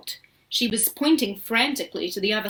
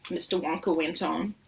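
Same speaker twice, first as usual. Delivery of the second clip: plain reading voice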